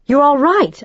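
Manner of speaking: loud high surprised cry; sudden loud exclamation; exclaiming. A narrator's reading. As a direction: blurt it out in relief and shock. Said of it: "I exclaimed".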